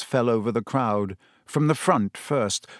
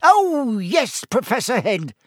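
very high, louder opening, gloating; Peeves, relishing a bombshell; 10 semitones higher, 4 dB louder than usual. A narrator's reading. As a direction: savour the secret in an oily high voice. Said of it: "with the air of one cradling a large bombshell".

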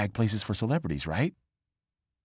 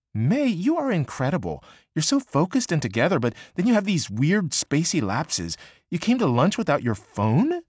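higher, gushing friend voice; bubbly Annie; 9 semitones higher than usual. Acted. Annie fondly scolding May for forgetting her phone, gushing and higher. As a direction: gush over her like a delighted best friend.

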